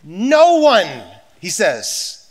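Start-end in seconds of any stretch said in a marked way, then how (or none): 0.0-1.0 emphatic. Acted. Emphatic quote in sermon.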